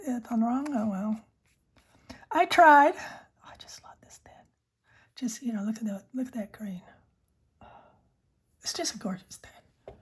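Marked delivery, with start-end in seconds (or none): 3.0-10.0 unvoiced ASMR whisper; soft whisper. Real, not acted. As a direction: breathe the words close to the mic. Whispered ASMR pen chat.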